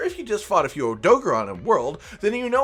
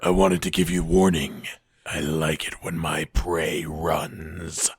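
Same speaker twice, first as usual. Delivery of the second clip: very low, drawn-out villain voice; menacing predator; slower than usual. Acted. Intruder giving warning to prey, low menacing voice. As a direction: sink right down and savour the threat.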